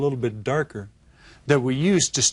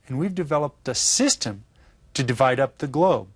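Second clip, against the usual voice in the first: usual speaking voice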